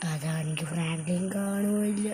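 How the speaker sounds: whispered so Franklin can't hear; conspiratorial whisper to the viewers; secret whisper. Pretend. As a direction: hush it like a hiding kid. Hiding the car from Franklin.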